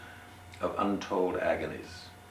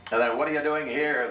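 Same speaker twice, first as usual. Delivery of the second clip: higher, louder sneer; sneering sailor; 8 semitones higher, 6 dB louder than usual. Acted. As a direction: sneer it, pitched up. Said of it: "the skeptical man sneered".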